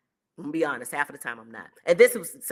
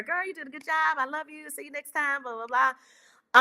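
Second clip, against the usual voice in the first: imitating a fan's hug; 10 semitones higher than usual. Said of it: "the regular".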